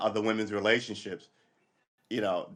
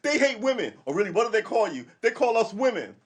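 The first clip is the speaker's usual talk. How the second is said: higher, louder mock exchange; mock dialogue; 10 semitones higher, 5 dB louder than usual. Host acting out debate with women, mock dialogue. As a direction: act out both sides of the argument.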